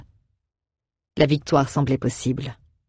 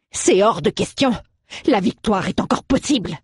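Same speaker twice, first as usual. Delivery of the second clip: raised, insistent protest; angry refusal; 5 semitones higher than usual. Acted. Alroeg refusing surrender, raised and insistent. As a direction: refuse it outright. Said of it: "s'exclama Alroeg".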